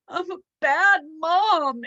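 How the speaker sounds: high, loud, slow wailing shriek. Acted. Angela wailing to her husband that she is a bad mom, shrieking. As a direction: wail it out at the top of the voice. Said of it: "Angela shrieked".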